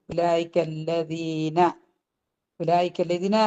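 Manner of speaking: slow, soft recited phrase; slow tajweed recitation; Quran recitation. Shown as a demonstration. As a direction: chant it slowly and gently.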